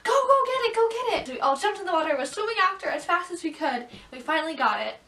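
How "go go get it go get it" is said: high, loud urgent dad shout. Retold or imitated. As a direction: yell at the kids to go. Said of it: "my dad was like".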